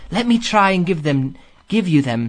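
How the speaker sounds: ordinary delivery, nothing marked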